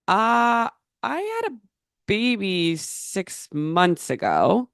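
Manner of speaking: disbelieving, drawn-out correction to the family member; incredulous reply. Retold or imitated. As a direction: pitch leaping high on "I had a", then a slow "baby six months ago". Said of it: "I look back at this family member and I say".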